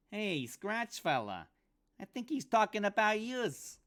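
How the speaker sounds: a weaselly sidekick needling his mark; pinched high voice. Acted. Pinky voiced in dialogue.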